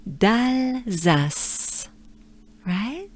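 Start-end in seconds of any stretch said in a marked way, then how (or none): 0.1-1.9 d'Alsace in full French sound; French d'Alsace together. Shown as a demonstration. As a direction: say d'Alsace with a breath. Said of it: "Take a good breath".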